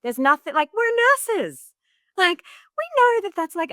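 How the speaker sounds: high, frustrated mock rant; exasperated. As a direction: throw up your hands at it. Exasperated retold quote to husband.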